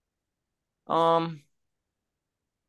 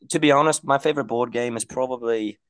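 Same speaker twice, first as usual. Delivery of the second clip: put-on British accent; 3 semitones higher than usual. Answering the board game question, mock British. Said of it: "we have to talk in a British accent".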